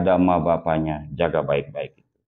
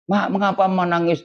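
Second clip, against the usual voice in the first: higher pitch, worried son asking mother; son's worried question; 8 semitones higher than usual. Retold or imitated. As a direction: ask mother gently why she cries. Son asks crying mother.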